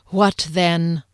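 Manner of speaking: stern ghostly sentinel; demanding interrogator's voice; menacing guard. A narrator's reading. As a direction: press the captive for an answer.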